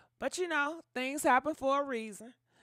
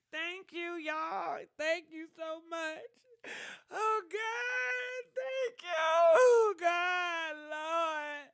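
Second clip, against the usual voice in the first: high, crying, voice breaking; overwhelmed with tears, thanking everyone; tearful thanks; 5 semitones higher, much slower than usual. Real, not acted. Emotional thanks to donors, crying.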